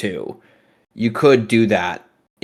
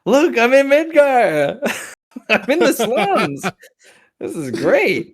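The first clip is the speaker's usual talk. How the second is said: giddy excited; 12 semitones higher than usual. Pretend. Posing in a Midgar photo.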